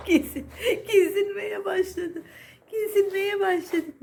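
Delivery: high, drawn-out words through laughter; slow, giggly and high; real amusement. Real, not acted. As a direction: laugh as you say it, pitch up. Amused by the pet wandering about.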